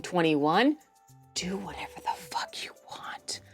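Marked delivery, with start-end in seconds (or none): 1.3-3.4 hushed whisper, almost no voice. Real, not acted. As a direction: drop to a whisper. Sudden whisper in rant.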